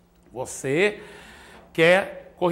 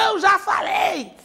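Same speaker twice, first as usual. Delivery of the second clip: much higher, louder exasperated shout; mock yell; 13 semitones higher, 4 dB louder, slower than usual. Parent losing patience with child, hammed-up yell. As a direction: blow up like a fed-up parent. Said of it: "Aí o que que vem?".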